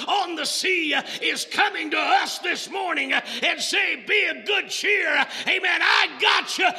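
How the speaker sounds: loud, high, rhythmic preaching; impassioned sermon climax; preaching at full force. Acted. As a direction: drive it home to the congregation.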